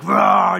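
short loud roar; mock roar. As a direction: roar as if jumping out at someone. Rehearses jumping out to scare them.

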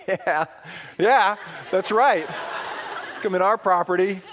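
high mock taunt, laughing; cocky gloating. Boy behind his brave dad taunting the fleeing dog, gloating. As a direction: crow after it with playful bravado.

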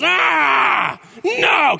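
put-on high whiny toddler refusal; fussy toddler protest. Preacher mimicking baby refusing diaper change. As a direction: squeal it like a baby fighting a diaper.

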